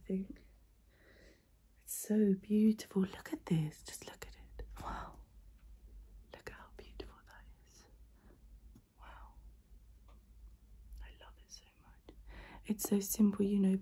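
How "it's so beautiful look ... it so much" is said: awed hush. Real, not acted. Admiring a photo, fading.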